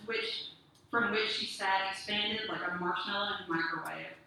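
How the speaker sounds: usual speaking voice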